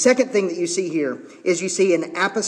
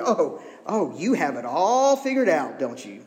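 sarcastic. Acted. Paul to self-important Corinthians, sarcastic. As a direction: feign being impressed by a know-it-all. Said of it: "being wholly sarcastic".

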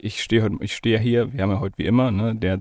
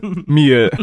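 laughs out his punchline; laughing; 6 semitones higher than usual. Real, not acted. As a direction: break into laughter on the words. Laughing at own joke.

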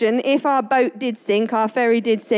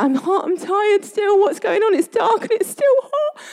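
put-on high, whining little-girl voice; whiny child; 9 semitones higher than usual. Daughter complaining to mum about the heat, high and whiny. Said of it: "Ava was like".

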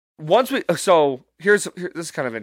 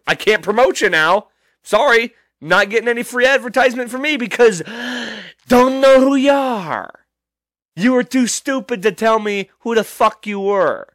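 mock taunting; 6 semitones higher than usual. Real, not acted.

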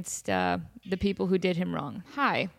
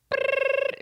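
high buzzing ring sound; phone ring imitation. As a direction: buzz like a ringing speaker. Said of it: "It rings again".

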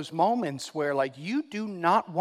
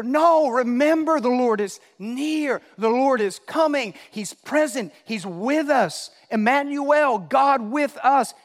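high, loud, drawn-out proclamation; impassioned preacher's cry; full-force preaching; 6 semitones higher, 6 dB louder than usual. Real, not acted. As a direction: proclaim it with passion, stretching key words.

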